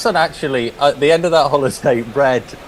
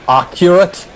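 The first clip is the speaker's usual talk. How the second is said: higher, louder one-word punchline; imitated deadpan client; 7 semitones higher, 5 dB louder, slower than usual. Belfast client answering the Englishman about shooting, imitated punchy retort. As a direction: land the retold reply hard.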